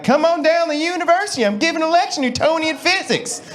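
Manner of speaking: high-pitched mock Southern twang. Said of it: "Nobody sounds smart with a Southern twang".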